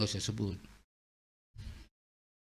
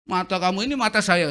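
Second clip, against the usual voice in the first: much higher, loud angry yell; fierce driver; 7 semitones higher, 9 dB louder than usual. Retold or imitated. Angry driver yelling through the window, loud fury. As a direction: bark at him for his eyes. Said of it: "Dia lebih galak lagi".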